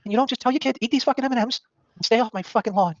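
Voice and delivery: high-pitched put-on retelling voice; high mock explanation on the doorstep; imitated John at the door. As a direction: act out a high-voiced, peeved neighbour. Voices John's reply at the door.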